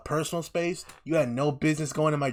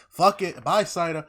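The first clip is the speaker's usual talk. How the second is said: raised, louder tough-guy line; mock tough shout; 5 semitones higher, 5 dB louder, slower than usual. Retelling the gunman's line, louder and careless. Retelling Tom Hardy's character shooting.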